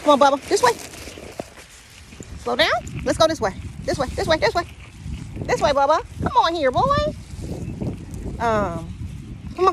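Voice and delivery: calling the dog. Real, not acted. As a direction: call the dog back to you.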